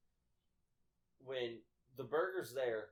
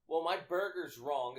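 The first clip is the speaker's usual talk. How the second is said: loud, higher, drawn-out customer complaint; mock screaming complaint; 7 semitones higher, 10 dB louder, slower than usual. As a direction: imitate an irate customer, slow and loud. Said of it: "she's sitting there screaming".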